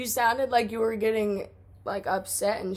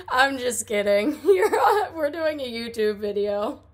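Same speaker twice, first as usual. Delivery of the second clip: laughing reveal; 2 semitones higher, 5 dB louder than usual. Real, not acted. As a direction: break into laughter while owning up to a prank. Caller reveals the prank, laughing.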